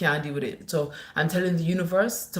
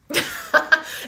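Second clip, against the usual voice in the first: bright high burst of laughter; real laugh; 9 semitones higher, 4 dB louder than usual. Real, not acted. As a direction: laugh out at the comment. Laughing at a comment.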